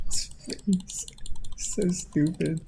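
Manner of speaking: whispered, slow scorn; mocking whisper. Real, not acted. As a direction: hiss it like a secret insult. Mocking the actor's face.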